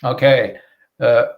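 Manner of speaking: ordinary talk, nothing marked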